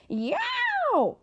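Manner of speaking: very high, loud drawn-out ghost shriek; Day Day imitates the ghost's shriek for the children; ghostly shriek. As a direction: let out a piercing ghostly wail. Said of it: "his version of the ghostly shriek".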